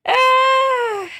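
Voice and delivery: high, slow words broken by giggles; talking through laughter. Real, not acted. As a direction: struggle to speak while cracking up. Still helpless with laughter.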